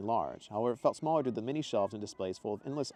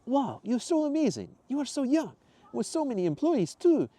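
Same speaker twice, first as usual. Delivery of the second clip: put-on much higher, louder slow foreign-accented praise; Mateo's accent; 6 dB louder than usual. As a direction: gush over her in a put-on accent.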